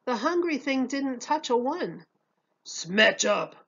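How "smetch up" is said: odd creature voice; monster voice. A narrator's reading. Hungry thing asking the head waiter for more, creature voice. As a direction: add it with a grumble. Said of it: "he added".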